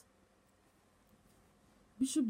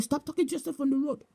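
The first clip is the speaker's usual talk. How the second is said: imitated scolding; 6 semitones higher, faster than usual. Bishop appearing to woman on the road, imitated scolding. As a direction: rattle off a mock telling-off.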